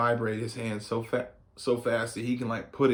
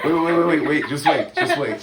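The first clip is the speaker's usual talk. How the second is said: laughing; 5 semitones higher, 8 dB louder than usual. Real, not acted. Mom stalls before being pied.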